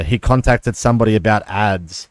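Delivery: ordinary talk, nothing marked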